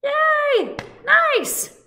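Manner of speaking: high, loud squeal of praise; high-pitched hooray; excited cheer. Real, not acted. Teacher cheers the children.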